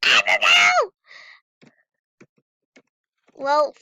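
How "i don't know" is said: high frustrated yell; shout. Real, not acted. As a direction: yell it out exasperated. Lost in game, panicking.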